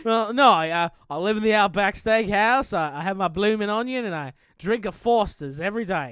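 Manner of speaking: broad, hammed-up Australian accent; Nicky the Aussie. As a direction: explain yourself as a cartoon Aussie. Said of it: "Nicky the Aussie".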